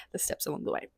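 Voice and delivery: unvoiced soft whisper on the echo; near-silent whispered echo of the steps along the way; hushed, savoring repeat. Shown as a demonstration. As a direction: breathe the phrase again, savoring it.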